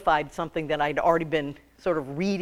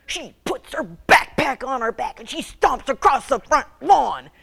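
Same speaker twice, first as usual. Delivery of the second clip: put-on raised, lively narration of a stomping girl; vivid, higher narration; animated storytelling; 6 semitones higher, 4 dB louder than usual. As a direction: tell it like the stomps are happening.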